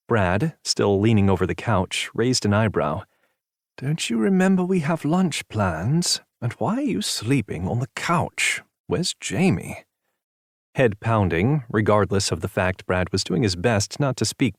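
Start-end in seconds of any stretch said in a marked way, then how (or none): 3.8-9.8 slow, higher teasing question; a lightly voiced concerned friend. A narrator's reading. Brad's voice.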